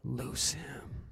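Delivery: whispered, soft command; hushed command. Acted. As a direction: breathe the words out as a gentle order. Jesus's command, spoken hushed.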